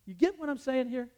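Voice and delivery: scolding lecture. Retold or imitated. Teacher lecturing a student about the door, loud scolding. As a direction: dress him down firmly. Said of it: "I'm talking to him like this".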